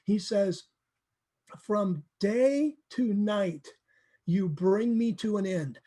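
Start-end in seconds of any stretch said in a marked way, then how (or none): 1.6-5.8 drawn-out, weighty verse reading; solemn, slow scripture reading; slow dramatic reading. Shown as a demonstration. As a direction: read it slowly, letting each phrase land. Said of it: "speaking very poetically here, very emotionally".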